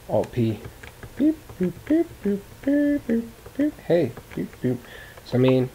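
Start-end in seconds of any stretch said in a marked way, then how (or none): 1.2-4.8 high beep boop robot noises. Pretend. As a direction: chirp like a toy robot.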